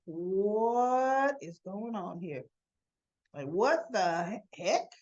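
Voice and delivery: slow, drawn-out incredulous question; mock disbelief. [name] as Cyrus walks in, slowly incredulous. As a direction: stretch the words out in astonishment.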